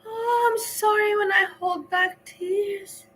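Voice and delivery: high, slow, squeaky mockery of her apology; mock teary whine. Host parodies Breezy's teary apology, high mocking whine. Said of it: "I'm so dramatic".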